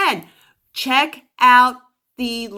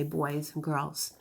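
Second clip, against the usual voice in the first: normal talking voice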